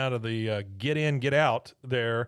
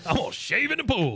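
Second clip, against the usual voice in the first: much higher squeaky mock outburst; hammed-up Buck; 10 semitones higher, 3 dB louder than usual. Retelling Buck's pool line to co-host, hammed-up high outburst. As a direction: overplay Buck's huff.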